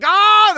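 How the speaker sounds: high, loud yelp of alarm; imitated scream of someone sliding helplessly; mock scream. Imitates people sliding into glass.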